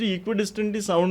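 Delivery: ordinary delivery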